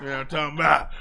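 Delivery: [name] voice. Retold or imitated. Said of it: "In my [name] voice".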